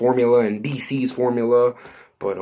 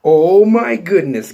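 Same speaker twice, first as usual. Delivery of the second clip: amazed exclaim; 7 semitones higher, 8 dB louder than usual. Real, not acted. Reacting to stunning news.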